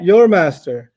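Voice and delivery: "Your" leaned on, long and loud; firm emphasis on "Your", then "master"; stress on Your. Shown as a demonstration. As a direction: correct them by punching "Your". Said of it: "You can't use our or my".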